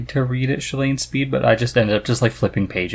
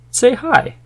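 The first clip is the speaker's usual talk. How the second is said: eager prompt; 13 semitones higher, 5 dB louder than usual. Real, not acted. Husband inviting his wife to greet listeners, eager. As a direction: lift it high and bright. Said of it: "prompting wife to greet".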